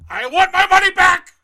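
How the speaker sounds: loud, high angry shout; furious yell. Acted. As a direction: demand the money back at full volume. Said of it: "Sir, please do not yell at me".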